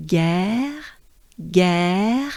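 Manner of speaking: "guerre" said slowly with a rising end and a French R. Shown as a demonstration. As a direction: breathe in, then model "guerre" for repetition. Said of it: "Let's do it together".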